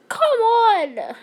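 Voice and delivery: high, loud frustrated shout; frustrated yell. Real, not acted. Frustrated gamer fails.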